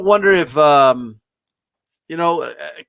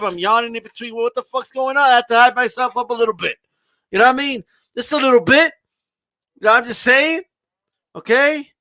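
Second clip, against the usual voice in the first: normal talking voice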